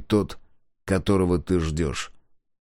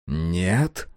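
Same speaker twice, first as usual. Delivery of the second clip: incredulous. Acted. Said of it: "недоверчиво переспросил Сайлос".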